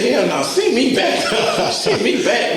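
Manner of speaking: mock-plead through laughter; laughing protest. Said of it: "I said".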